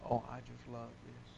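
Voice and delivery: quiet mock delight; sarcastic. Real, not acted. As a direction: say it low with dry sarcasm. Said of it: "I'm being sarcastic".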